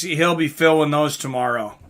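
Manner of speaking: ordinary delivery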